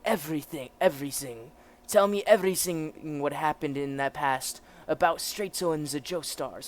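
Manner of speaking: commanding officer with a put-on German accent; German officer character. German officer explains the prisoner's state, acted officer voice.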